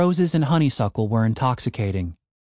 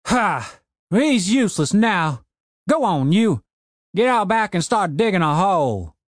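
put-on cranky old man; 7 semitones higher than usual. A narrator's reading. Old man ordering his broken zombie gardener, gruff old voice.

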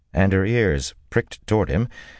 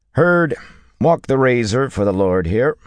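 high, loud order to a stablehand; raised voice across the stable; calling out; 8 semitones higher, 5 dB louder than usual. A narrator's reading. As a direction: call the name sharply, then give the order. Dealer calls order to stablehand.

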